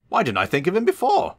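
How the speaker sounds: a little engine struck by an idea; high excited whistle of a thought; whistled out. A narrator's reading. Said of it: "whistled Edward".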